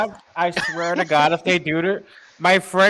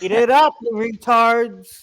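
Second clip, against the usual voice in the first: loud and higher, gloating like a greedy seller; mock gloating taunt; 6 semitones higher, 6 dB louder, slower than usual. Mocking the card company gouging players, loud gloating jeer.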